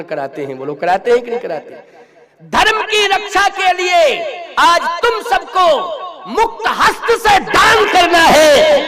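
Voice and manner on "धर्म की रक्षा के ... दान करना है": high, loud exhortation with drawn-out words; slow, ringing shout to rouse the crowd; full-force preaching. Acted. Preacher urging everyone to give for dharma, full-force preaching.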